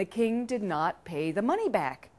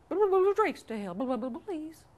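high, fast burbling plea; pleading river voice; 7 semitones higher, faster than usual. Acted. River begs once more.

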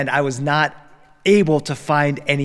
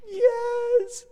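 put-on tiny high kid's yes; small child voice; 4 dB quieter than usual. Crying son answering his dad, high little child voice. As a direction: answer like a teary toddler.

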